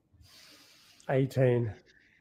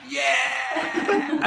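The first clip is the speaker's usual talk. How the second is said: excited shout; 9 semitones higher than usual. Real, not acted. Host announces workout, laughing.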